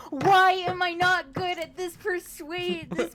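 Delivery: loud, high, drawn-out lament; exasperated wail. Real, not acted. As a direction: wail at your bad luck, slowly. Player laments bad performance roll.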